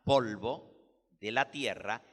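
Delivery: usual speaking voice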